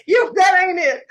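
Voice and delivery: laughing at her own joke; cracking up mid-sentence. Real, not acted.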